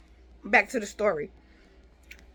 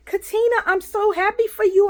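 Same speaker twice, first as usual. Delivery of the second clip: lightly imitated nurse, much higher and bright; imitating the nurse; 11 semitones higher than usual. Nurse at the door congratulating Katina, mild happy imitation. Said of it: "she said".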